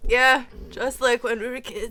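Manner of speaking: can't keep a straight face; laughing through it. Real, not acted. Friend reads Magenta's line while giggling.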